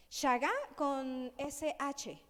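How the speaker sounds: slow shagá, sh sound and stress on final A; pronunciation demo. Shown as a demonstration. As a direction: spell out shagá, accent on last A. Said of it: "acento en la última A".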